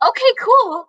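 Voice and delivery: voice went up. Retold or imitated. Waitress to Ben Stiller, nervous high-pitched okay cool. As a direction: squeak it out high like a starstruck fan. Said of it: "And my voice went up".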